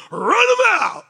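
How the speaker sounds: high, loud hammed-up mob shout; mock mob cry. Owners urging each other to drive renters out, hammed-up shout. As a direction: bark it like an angry neighborhood mob.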